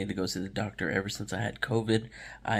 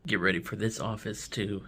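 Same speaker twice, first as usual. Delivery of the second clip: ordinary talk, nothing marked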